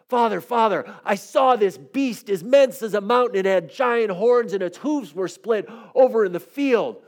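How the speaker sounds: high, eager storybook youngster; excited little frog. Acted. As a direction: report a huge sighting to Father in awe. Little frog excitedly reports a beast.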